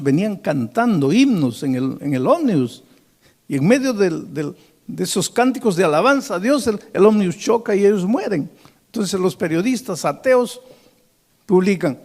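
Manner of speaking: the preacher climbs toward the tragedy; preaching. Acted. Scene: a preacher tells the congregation of the bus crash, fervent.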